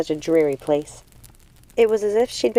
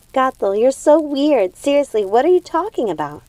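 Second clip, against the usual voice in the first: a sister's exasperated teasing, pitched up; mocking sister; 8 semitones higher, 5 dB louder than usual. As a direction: scoff at her sister's oddness. Primrose mocking her sister.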